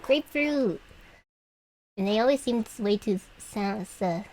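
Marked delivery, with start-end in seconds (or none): 0.0-0.8 gleeful exclaim. Real, not acted. Mock-relishing the word.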